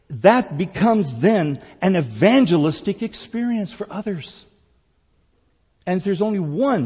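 loud, high, emphatic preacher's delivery; full-force preaching. Real, not acted. Preacher proclaiming worship to the congregation, full-force preaching. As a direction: drive the point home to the congregation, loudly.

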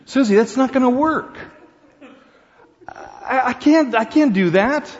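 high, loud, half-laughing refusal; Johnny's shocked voice. Acted. Groom refusing his fiancée's request, high and loud through laughter.

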